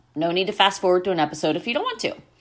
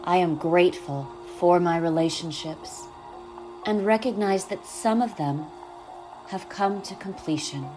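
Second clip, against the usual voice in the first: calm, unhurried guiding voice; guided meditation; 5 dB quieter than usual. Acted.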